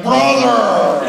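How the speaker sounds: high, loud, laughing cry; hammed-up Edward Elric. Imagining himself ruining Full Metal Alchemist, hammed-up. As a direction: overdo the anime cry. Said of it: "I'd be like".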